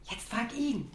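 usual speaking voice

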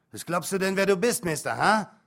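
bristle, wounded and furious; indignant father. Acted. Hurt father rounding on his cheeky son, angry and loud.

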